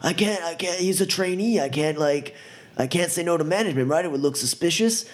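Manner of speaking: high, stammering excuses; flustered pleading. Acted. Roughed-up driver pleading with thug, high and flustered. Jerry pleading while shoved around.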